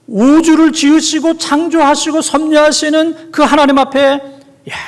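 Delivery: high, loud, rising fervor; fervent preaching. Acted. Preacher exalts the creator God before his congregation, in full-force preaching. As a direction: proclaim God's greatness to the congregation.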